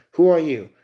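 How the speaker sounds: loud, sharp question; shouted demand. Pretend. Character confronting a stranger, loud demand. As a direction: bark it at a stranger.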